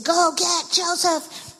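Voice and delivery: high, slow call to fetch Joseph. Acted. Servants summoning Joseph for Pharaoh, high slow call. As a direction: shout the order up high and slow.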